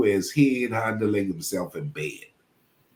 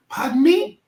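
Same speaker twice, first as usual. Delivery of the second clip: incredulous; 5 dB louder than usual. Retold or imitated. Stunned reply to rebuke.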